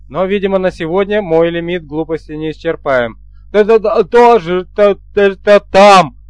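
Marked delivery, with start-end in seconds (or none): 3.5-6.2 raised, loud, happy stammer; joyful stuttering. A narrator's reading. Guard gladly confirming to his chief, joyful stutter. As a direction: stammer eagerly up to a loud final word. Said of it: "радостно подтвердил стрелец".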